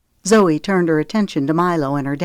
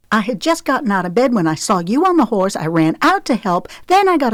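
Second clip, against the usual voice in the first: fast, tumbling excuses; rushed explanation; 5 semitones higher, faster than usual. Acted. Explaining to her father in a fast embarrassed rush. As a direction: rush the words out in a hurry. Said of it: "tongue started moving at warp speed".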